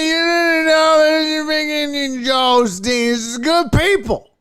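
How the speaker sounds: put-on high, loud, slow drunken slur; mumble-shout it like a sloppy drunk; drunk heckler. Drunk heckler defends Osteen to the comedian, slurred hollering. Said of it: "had way too much to drink".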